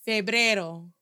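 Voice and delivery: Febrero in clean Spanish, feh-BREH-ro with a tapped r. Shown as a demonstration. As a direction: model Febrero the native way, crisp open vowels. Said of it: "Pronounce the word February, the month February with me".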